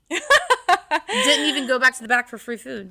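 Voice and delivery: said through laughter; laughing. Real, not acted. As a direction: laugh while you say it. Laughing while telling the story.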